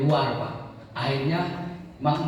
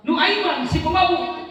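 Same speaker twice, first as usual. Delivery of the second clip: ordinary talk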